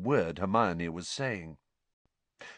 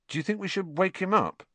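awkward; 11 semitones higher, faster than usual. A narrator's reading. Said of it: "Ron asked awkwardly".

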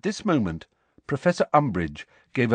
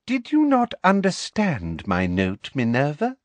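sugary, condescending question; honeyed; 6 semitones higher than usual. A narrator's reading. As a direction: ask with cloying false sweetness. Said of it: "asked Professor Umbridge in honeyed tones".

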